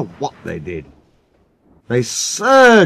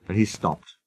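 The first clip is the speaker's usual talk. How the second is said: ordinary delivery, nothing marked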